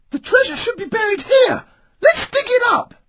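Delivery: put-on high, eager young pirate voice; eager pirate boy. A narrator's reading. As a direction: urge the crew on brightly. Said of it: "he said".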